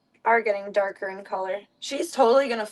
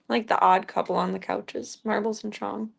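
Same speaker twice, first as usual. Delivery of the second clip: ordinary talk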